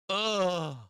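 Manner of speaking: loud, high groan of disgust; frustrated groan. Real, not acted. Groans at losing trade.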